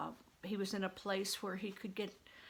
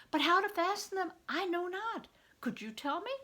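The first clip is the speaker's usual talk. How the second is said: put-on boy's voice; 10 semitones higher, 4 dB louder than usual. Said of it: "Robin asks the monk".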